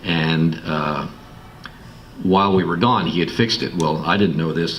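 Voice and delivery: ordinary talk, nothing marked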